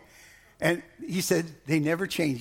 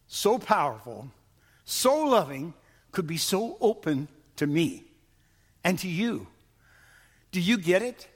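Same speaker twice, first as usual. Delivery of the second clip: usual speaking voice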